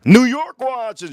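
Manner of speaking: loud, high, slow call-out; excited shout. Real, not acted. Streamer calling out New York viewers, loud and high. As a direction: announce New York like a crowd hype.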